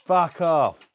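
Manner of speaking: loud, high annoyed yell; shouting at the parrot. Real, not acted. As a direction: snap it at the bird loudly.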